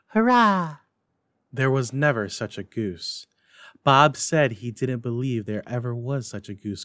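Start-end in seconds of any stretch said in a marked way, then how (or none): 0.1-0.8 put-on a high, thin little boy's cry of hurrah; feeble child's cheer. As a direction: cheer weakly like a frail small boy. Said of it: "feebly cried".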